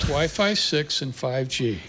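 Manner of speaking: slow, careful spec reading. Real, not acted. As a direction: ease off and take it slowly. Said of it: "Slow down".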